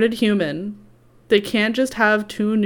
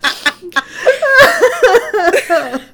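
high, loud burst of laughter; uncontrolled high cackling laugh; shrieking laugh; 9 dB louder than usual. Real, not acted. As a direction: crack up loudly, pitched way up. Cracking up at sarcastic line.

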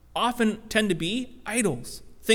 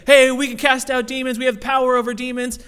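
louder, higher bragging report; excited boast; 5 semitones higher, 6 dB louder than usual. Retold or imitated. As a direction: announce the win proudly. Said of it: "They say".

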